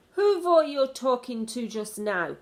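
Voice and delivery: loud curt demand; snapped. A narrator's reading. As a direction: snap the question. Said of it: "snapped the Grand High Witch".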